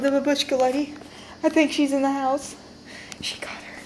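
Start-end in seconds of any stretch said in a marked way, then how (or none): 3.2-3.8 whispering. Acted. Hiding from babushka.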